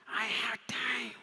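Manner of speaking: slow, hushed puppet voice; insistent puppet. Pretend. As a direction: drag it out in a puppet whisper. Puppet insists it has time.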